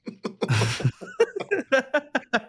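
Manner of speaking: long, high laugh; real burst of high laughter. Real, not acted. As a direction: laugh at your own funny story. Laughing at the retold anecdote.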